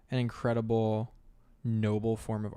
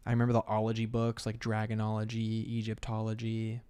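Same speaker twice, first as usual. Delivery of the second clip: usual speaking voice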